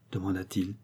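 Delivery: plain reading voice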